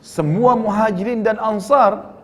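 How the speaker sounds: high, slow, stretched-out mock disbelief; incredulous question. Jabir asks the Prophet about inviting everyone, incredulous. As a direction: echo it back slowly in acted astonishment.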